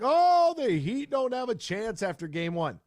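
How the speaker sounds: mock the naysayers who wrote the Heat off; mocking the doubters. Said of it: "a lot of people are like".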